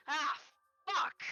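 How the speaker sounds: loud, high curse; caught-out yell; angry shout. Acted. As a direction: blurt it out furious. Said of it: "he goes".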